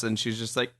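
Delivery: normal talking voice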